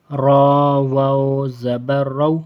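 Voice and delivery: long, chanted "را" with a full, heavy r; thick ra. Shown as a demonstration. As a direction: chant slowly, making "را" thick. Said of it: "موٹا پڑھیں گے را کو".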